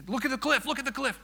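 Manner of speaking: high-pitched, insistent pointing out; excited urging. Retold or imitated. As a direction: urge him eagerly to look. Urging scared passenger to look.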